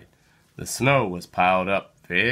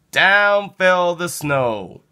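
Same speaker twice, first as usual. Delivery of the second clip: slow dramatic telling; 7 semitones higher, 4 dB louder, slower than usual. A narrator's reading. Snow falls from tree.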